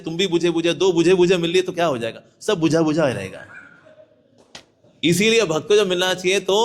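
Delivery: ordinary talk, nothing marked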